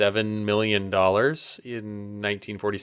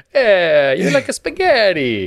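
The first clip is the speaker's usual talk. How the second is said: much higher and louder cartoon Italian waiter; mock Italian accent; 11 semitones higher, 10 dB louder than usual. Cohost joins the bit, high cartoon Italian accent. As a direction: ham up a cartoon Italian offering spaghetti. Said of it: "Italian accent".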